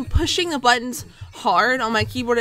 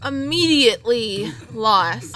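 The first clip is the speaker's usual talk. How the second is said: ordinary delivery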